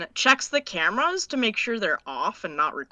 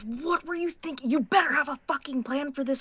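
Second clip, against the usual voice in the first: exasperated scolding; 5 semitones higher, faster than usual. Acted. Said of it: "she just drops him from the wall and she goes".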